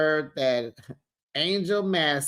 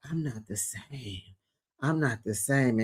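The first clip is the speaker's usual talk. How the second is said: hushed imitation; 5 semitones lower, 8 dB quieter than usual. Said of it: "she's like".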